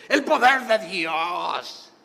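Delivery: slow, drawn-out mock-grand cry; parodied revivalist fervour. Christians who want only power, mocked grandiosely. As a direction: stretch it out, hamming up the fervour.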